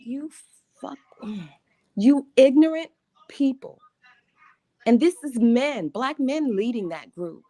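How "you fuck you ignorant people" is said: retold angry snap, louder at the end; angry outburst. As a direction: lash out at the mob. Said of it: "I'm like".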